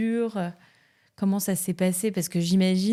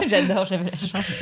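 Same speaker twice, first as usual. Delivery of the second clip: laughing echo; laughing as she teases the guest. Real, not acted. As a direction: repeat her words through a laugh. Teasing echo of her remark.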